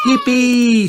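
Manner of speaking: put-on high, drawn-out child's cheer; excited shout. A narrator's reading. Child offered hide and seek, excited shout. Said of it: "shouts Peppa".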